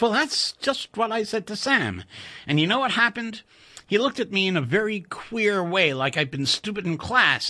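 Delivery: put-on high, stammering young American engineer; eager colleague spilling a secret, pitched high; excited Chuck. A narrator's reading. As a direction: blurt it out, tripping over the first word. Narrator voices Chuck.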